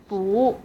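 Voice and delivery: 不 rising like a question; second tone on 不. Shown as a demonstration. Teacher picking out the second tone, rising 不. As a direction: start 不 mid and lift it. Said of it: "second tone".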